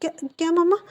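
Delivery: high, startled stammer; can't believe what she just heard; stunned, stammering question; shocked disbelief. A narrator's reading. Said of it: "उसकी आंखें बड़ी हो जाती".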